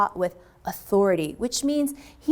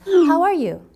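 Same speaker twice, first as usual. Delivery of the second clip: curt angry reading; 8 semitones higher, 6 dB louder than usual. Shown as a demonstration. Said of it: "It sounds a little angry because of that one period".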